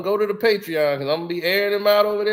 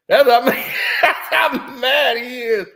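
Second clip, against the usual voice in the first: real laugh breaking into the words; loud, tickled, laughing through it; 4 semitones higher, 5 dB louder than usual. Real, not acted. Host cracking up at how mad a rival got, laughing.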